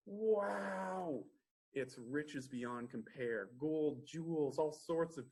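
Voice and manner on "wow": gasp, then a high, awed wow; amazed gasp. Acted. Fisherman opening the treasure chest, gasping in awe. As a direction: let the treasure take your breath away.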